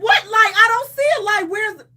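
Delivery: frantically searching for the light, scared; panicked retort. Retold or imitated. Panicking at joke about light.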